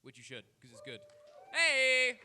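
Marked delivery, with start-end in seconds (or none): 1.5-2.2 big shouted hey; loud cheer. Real, not acted. Plugging his tape series, loud cheer.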